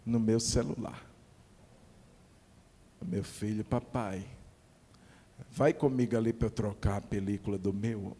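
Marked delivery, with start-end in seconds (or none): 3.0-8.2 voicing the son. Son asks father in a story.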